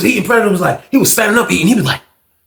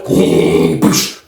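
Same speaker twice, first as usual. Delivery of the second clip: door hiss sound. Retold or imitated. Imitates the cell door opening.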